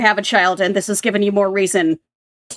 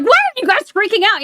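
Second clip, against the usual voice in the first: much higher, loud incredulous cry; exasperated panic; 12 semitones higher, 3 dB louder than usual. Retold or imitated. Sharon to calm townsfolk, high frantic disbelief. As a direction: fling it at them, baffled and frantic. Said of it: "And she's like".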